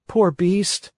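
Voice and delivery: lightly voiced wooden puppet; a hint of a curious puppet. Pinocchio pities the donkey.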